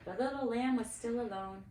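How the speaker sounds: plain reading voice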